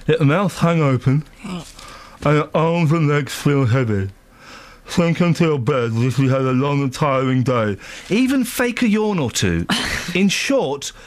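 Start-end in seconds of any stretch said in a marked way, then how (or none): 0.1-7.8 slow, drowsy bedtime read; sleep story narrator. Acted. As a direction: lull them to sleep. Reads relaxation tips slowly.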